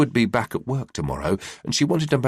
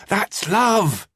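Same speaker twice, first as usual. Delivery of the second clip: playful shout; 11 semitones higher than usual. A narrator's reading. Mum squeezing her son harder, playful shout. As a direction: shout it while squeezing him tight. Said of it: "she shouted".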